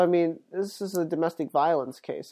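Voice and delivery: usual speaking voice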